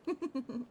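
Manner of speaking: a quick laugh at her own teasing; short laugh. Acted. Catgirl laughing after teasing her partner, short laugh.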